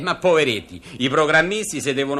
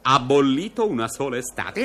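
normal talking voice

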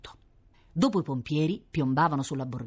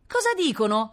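put-on high, raised child's call; shrieking little girl; 10 semitones higher, 4 dB louder than usual. A narrator's reading. Little sister calling from the bathroom, shrill child's yell. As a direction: call out high from another room. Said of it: "strillò Rita dal bagno".

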